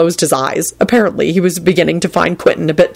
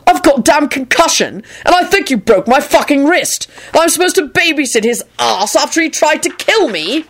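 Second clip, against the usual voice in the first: high, indignant protest; shouted outrage; 9 semitones higher than usual. A narrator's reading. Injured man objecting to babysitting duty, outraged raised voice. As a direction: complain in a much higher voice. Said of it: "Quentin shouted".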